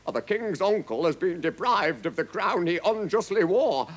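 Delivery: high, declaimed report; formal captain. A narrator's reading. Captain answering the furious king, declaiming. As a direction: report it boldly to the throne.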